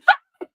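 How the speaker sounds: laugh. Real, not acted. Laughs after rant.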